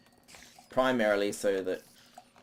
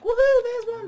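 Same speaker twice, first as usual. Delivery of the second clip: much higher, much louder whoop of joy; excited whoop; 12 dB louder than usual. Retold or imitated. Thinking he spotted an Enderman, a loud excited whoop. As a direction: shout it like you've spotted your prey. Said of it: "I was like".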